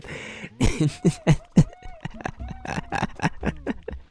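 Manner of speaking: a mock, very high and unvoiced Toad screech; high squeaky screech.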